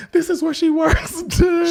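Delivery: very high, loud mock outburst; retold agitated discovery. Wife showing where the woman works, retold agitated outburst. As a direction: imitate her high-pitched, worked-up announcement.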